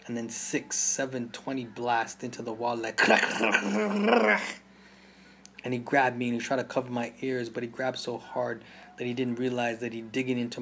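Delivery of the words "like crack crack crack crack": high, loud cracks of gunfire; gunshot cracks. A narrator's reading. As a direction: snap out each crack like a shot. Gunfire imitated in story.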